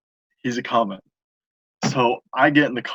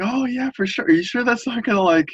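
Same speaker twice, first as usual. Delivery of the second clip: doubtful cashier; 12 semitones higher than usual. Retold or imitated. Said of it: "they're just like".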